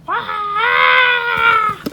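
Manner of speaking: high loud yelp of alarm; startled cry. Real, not acted. Crafter yelping as the balloon tips, high and loud. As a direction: cry out as it falls.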